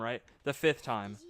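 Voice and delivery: ordinary talk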